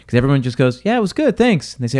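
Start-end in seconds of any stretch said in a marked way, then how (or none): 0.9-1.7 high, chirpy mock brush-off, much higher; breezy brush-off. Guest to the manager asking how it was, mock breezy brush-off. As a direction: toss it off like a guest who wants the manager gone.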